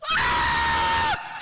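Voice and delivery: very high, loud battle shriek; hammed-up hysterical war cry; frenzied scream. Big man attacking scorpion with tissue, frenzied scream.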